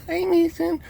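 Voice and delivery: high little cooing voice; doting pet greeting. Real, not acted. Owner greeting Mason at sign-off, high cooing.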